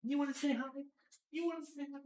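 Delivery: high, cooing voice for the cat; pet talk. Real, not acted. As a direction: coax the kitty in a sing-song voice. Talking to her cat.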